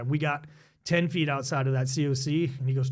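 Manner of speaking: usual speaking voice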